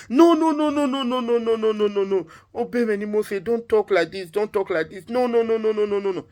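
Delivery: high, rapid-fire protest; frantic refusal. Acted. King refuses his wife's offer to be sacrificed, frantic and high-pitched. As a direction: start very high and loud, tumbling down through repeated denials.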